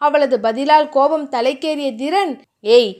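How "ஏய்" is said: plain reading voice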